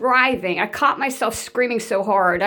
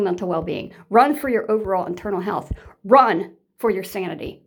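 ordinary talk

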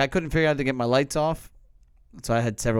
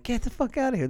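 much higher, thrilled outburst; excited disbelief; 10 semitones higher than usual. Retold or imitated. Hearing Metallica is coming, excited. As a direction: blurt it out in delight.

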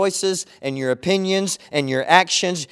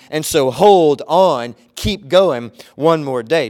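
slow urging; 6 dB louder, slower than usual. Acted. Urging to persevere.

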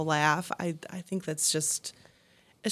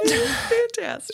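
breathless excited; 4 dB louder, slower than usual. Real, not acted. Recovering after laughing fit.

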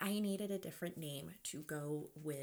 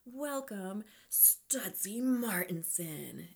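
big, slow showman's announcement, much higher; drawn-out club announcer intro; stage announcer; 3 semitones higher, 5 dB louder, slower than usual. Pretend. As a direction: announce the male dancer like a stage host. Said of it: "You hear an announcer, and he says".

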